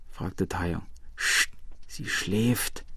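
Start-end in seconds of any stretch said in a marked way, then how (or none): 1.2-2.8 hushed shushing. A narrator's reading. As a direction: softly shush someone near a sleeper. Hushing because the old woman sleeps. (leise, gedämpft beschwichtigend)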